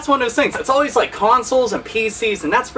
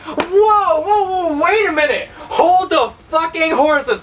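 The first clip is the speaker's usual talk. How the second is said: higher, hammed-up alarm; mock surprise; 6 semitones higher, slower than usual. Mocking shock at being told to just play games. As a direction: pretend to be shocked.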